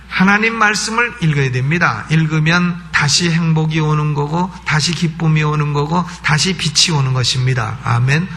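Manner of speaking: ordinary delivery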